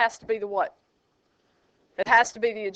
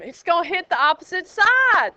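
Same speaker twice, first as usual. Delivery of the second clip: excited reveal; 6 semitones higher, 4 dB louder than usual. Real, not acted. Arrow lands, excited reveal.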